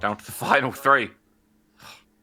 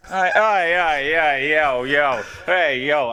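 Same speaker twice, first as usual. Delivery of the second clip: high, loud teasing calls; loud protest; 5 semitones higher, 5 dB louder, much slower than usual. Real, not acted. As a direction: call him out loudly. Protesting a friend's trick.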